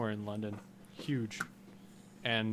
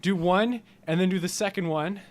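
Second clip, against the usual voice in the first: high, loud exasperated pleading; exasperated protest; 9 semitones higher, 6 dB louder than usual. Acted. Listener complaining to a comedian about saving it, exasperated. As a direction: protest in a raised, incredulous voice.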